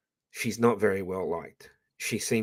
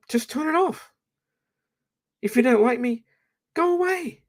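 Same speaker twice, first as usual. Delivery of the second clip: much higher, sing-song brush-off; mocking dismissal. Telling critics to leave, high mocking brush-off. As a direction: wave them off in a squeaky mock tone.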